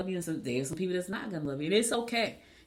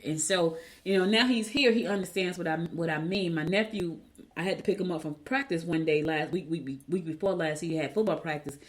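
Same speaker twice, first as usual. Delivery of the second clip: normal talking voice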